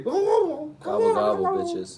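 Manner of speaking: high squawky gobbling turkey; turkey impression. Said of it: "That's my turkey impression".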